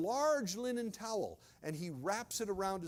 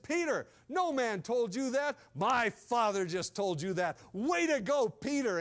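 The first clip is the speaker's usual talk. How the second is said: louder and higher, bursting with delight; excited praise; 4 semitones higher, 6 dB louder than usual. Acted. Jesus excitedly praising Peter for his answer, loud and delighted. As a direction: cheer him on like a proud teacher. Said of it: "Jesus gets really excited".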